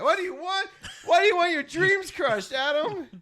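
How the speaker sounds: hammed-up high whine at a friend's bad idea; mock whiny plea. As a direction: whine it like a dramatic teen begging. Mock pleading at co-host.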